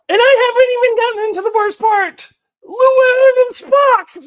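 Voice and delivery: very high, loud wail of outrage; exasperated outburst. Real, not acted. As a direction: cry it out in high-pitched despair. Exasperated outburst over worst part.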